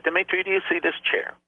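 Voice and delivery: high, taunting jailer's voice; menacing interrogator. Retold or imitated. Torturer taunts the pastor with the chair, menacing and high. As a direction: needle the prisoner with a cold question.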